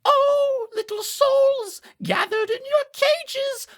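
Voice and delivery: very high, slow theatrical appeal; melodramatic Mira imp. Acted. GM as spotlit imp calling on caged souls, melodramatic declaiming. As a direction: plead grandly, stretching out the final words. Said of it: "they, they say".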